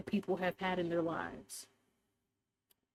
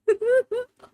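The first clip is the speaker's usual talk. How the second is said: high, loud burst of giggling; real giggle; 11 dB louder than usual. Real, not acted. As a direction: break into a sudden high giggle. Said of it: "I'm sorry for giggling so much".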